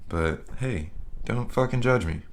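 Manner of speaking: ordinary talk, nothing marked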